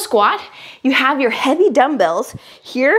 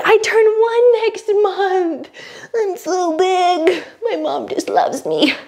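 put-on high slow baby voice; voicing the baby; 7 semitones higher, slower than usual. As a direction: speak for the baby, cooing.

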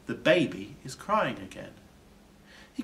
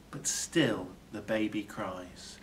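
ordinary delivery, nothing marked

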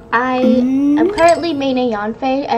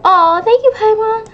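much higher, louder touched aw; delighted thanks; 10 semitones higher, 5 dB louder than usual. Real, not acted. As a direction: gush your thanks, really pleased. Paimon votes for him.

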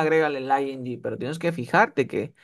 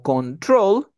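control drawn out with a loud second syllable, TROL; stress on final syllable; 5 semitones higher, 5 dB louder than usual. Shown as a demonstration. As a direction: lean on the end: con-TROL. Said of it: "si tiene la fuerza de voz al final". (control con la fuerza de voz en TROL, al final)

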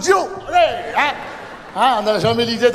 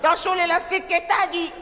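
higher, laughing mum giving advice; pitched-up proud mother, half laughing; mother reminding her son of his roots, higher; mother's voice; 6 semitones higher than usual. Retold or imitated. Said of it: "ça va y aller, hein".

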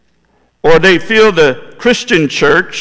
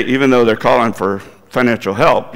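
normal talking voice

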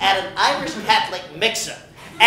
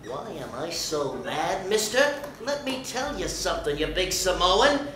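much lower and much softer, threatening; low menace; 5 semitones lower, 11 dB quieter than usual. Acted. Regis answering the linebacker, low quiet threat. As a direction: hiss it low before exploding.

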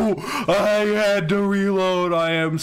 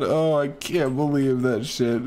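ordinary talk

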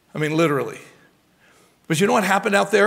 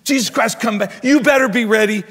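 higher-pitched mock doomsday warning; fear-mongering preacher; 5 semitones higher than usual. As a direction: mimic an alarmist preacher lightly. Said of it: "Playing on our worst fears".

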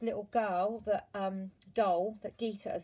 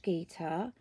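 ordinary delivery

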